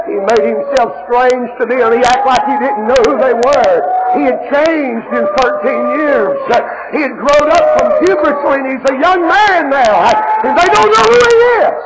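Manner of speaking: loud, high, ringing sermon voice; preaching at full force. Acted. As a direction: preach it out to the whole church.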